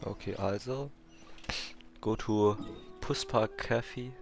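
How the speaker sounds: ordinary delivery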